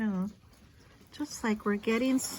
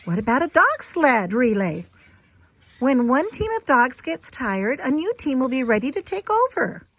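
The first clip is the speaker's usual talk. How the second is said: eager suggestion; 4 semitones higher, 11 dB louder than usual. A narrator's reading. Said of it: "someone said".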